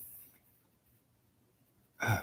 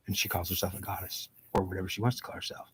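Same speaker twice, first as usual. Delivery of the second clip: whispered aside; 7 dB quieter than usual. Real, not acted. Scornful aside about mom.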